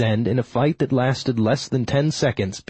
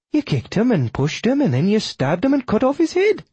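high widow voice climbing higher; upset, accusing widow; 10 semitones higher than usual. Acted. Widow recounts the killing to the killer, high and upset. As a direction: list his deeds in rising high-pitched alarm.

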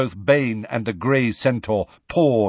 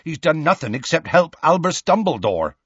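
higher, exasperated protest; impatient; 7 semitones higher, 3 dB louder than usual. A narrator's reading. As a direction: insist with impatience. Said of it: "said Hagrid impatiently".

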